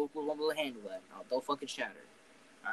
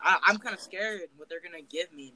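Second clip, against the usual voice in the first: ordinary delivery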